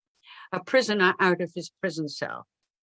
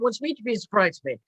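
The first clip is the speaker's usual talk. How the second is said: normal talking voice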